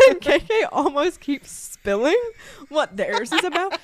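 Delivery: loud and high, with laughter breaking in; laughing tease. Real, not acted. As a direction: tell on your friend, cracking up. Teasing friend while laughing.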